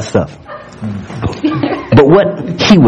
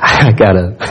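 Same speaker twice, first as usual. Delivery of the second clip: ordinary delivery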